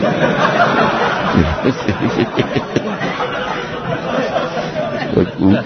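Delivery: long high-pitched breathy laugh. Real, not acted. Telling listeners how he insulted the boss, long high laugh. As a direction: burst out laughing and keep it going.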